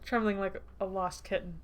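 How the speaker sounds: ordinary delivery, nothing marked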